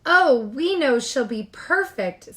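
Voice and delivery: proud man in the audience; confident spectator. A narrator's reading. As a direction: boast about her to the crowd. Man in crowd.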